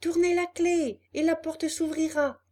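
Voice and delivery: high, piping little creature; tiny unseen host giving instructions. Acted. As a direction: call out in a small high voice. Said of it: "dit la même petite voix".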